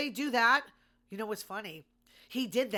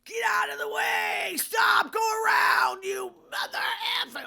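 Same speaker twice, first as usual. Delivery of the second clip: imitated screaming; 13 semitones higher, 9 dB louder than usual. Stranded man to snowplow in a blizzard, imitated screaming. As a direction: scream at the driver, full voice, as an imitation. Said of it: "he's screaming at the snowplow".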